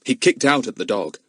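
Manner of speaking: ordinary delivery, nothing marked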